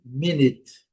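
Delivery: "minute" as "MIN-it", u sounded as i; English pronunciation. Shown as a demonstration. Teacher asking students to observe, English "minute". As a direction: model "minute" for learners, first syllable strong.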